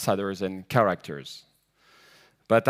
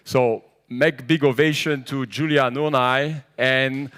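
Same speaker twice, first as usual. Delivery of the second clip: ordinary delivery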